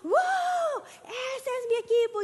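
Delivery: high, loud stadium whoop; imitated fan shout. Preacher imitating football fans shouting in the stadium, excited yell. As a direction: cheer like a fan roaring for the team. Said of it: "otros están gritando".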